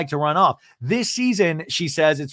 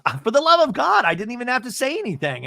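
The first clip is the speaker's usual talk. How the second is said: mock exasperation; 9 semitones higher, faster than usual. [name] exasperated talking head.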